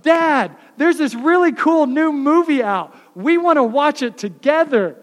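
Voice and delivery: lightly voiced children asking dad for a movie; eager kids voice. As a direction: play the kids pleading for a movie. Kids' request voiced in story.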